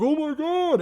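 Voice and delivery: high put-on gasp of mock surprise; fake shock. Scott Dawson acting shocked for cameras, high mock gasp. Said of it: "he's only acting for the cameras".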